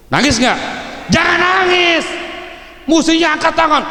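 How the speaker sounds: high, loud mocking challenge; mocking shout. Preacher taunting the cursing mother, high loud shout.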